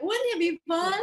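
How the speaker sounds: Monica. Retold or imitated. Host quoting Monica's catchphrase, lightly mock cheerful. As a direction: chirp the catchphrase brightly. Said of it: "what was it Monica was always saying".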